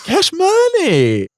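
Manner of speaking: very high, drawn-out silly jingle voice; hammed-up sound drop. Making new sounds for the pod, hammed-up high jingle. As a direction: stretch it out high, laughing.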